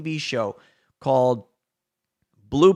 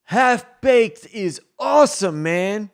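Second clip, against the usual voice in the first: higher, louder, slow stoner drawl; a leaving fan praises the movie, higher and louder; 6 semitones higher, 6 dB louder, slower than usual. Retold or imitated. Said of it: "he says".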